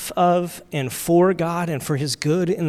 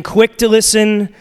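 raised, louder catchphrase delivery; higher, louder, crisp memory cue; memory phrase; 5 semitones higher, 7 dB louder than usual. Shown as a demonstration. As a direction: punch it out like a drilled slogan. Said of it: "we kinda had this little thing".

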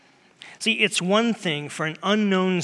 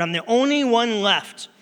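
higher-pitched lament, leaning on "only one"; acted complaint; 6 semitones higher, 4 dB louder than usual. Elijah telling God he is alone, acted complaint.

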